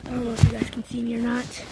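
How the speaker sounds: normal talking voice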